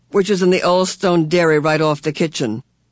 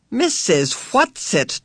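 slow, higher, suspicious naming; 5 semitones higher, much slower than usual. A narrator's reading. Charles addresses the strange night visitor, suspiciously. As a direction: draw the name out warily. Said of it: "Charles said suspiciously".

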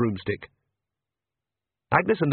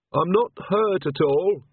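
slow, pointed and a little higher; pointedly; 7 semitones higher, slower than usual. Acted. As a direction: make the point without saying it. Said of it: "said Agnes pointedly".

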